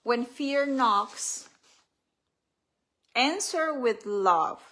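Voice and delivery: slow, spaced-out inspirational reading; reading from her book. Acted. Author telling listeners to answer fear with love, slow and deliberate. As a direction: draw out each word with weight.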